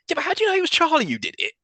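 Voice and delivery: high, fast incredulous question; skeptical. Retold or imitated. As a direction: rattle it off in disbelief. Own skeptical thought.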